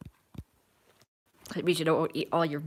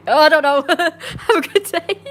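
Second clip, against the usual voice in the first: high, loud giggly squeal; laughing goodbye; 9 semitones higher, 8 dB louder than usual. Real, not acted. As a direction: crack up while saying goodbye, voice shooting up. Giggles while fumbling the goodbye.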